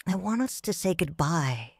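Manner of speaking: ordinary delivery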